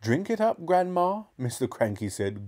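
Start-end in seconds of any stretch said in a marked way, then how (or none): 0.0-1.2 high, eager coaxing; grinning broadly. A narrator's reading. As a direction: urge it on gleefully through a grin. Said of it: "grinning hugely".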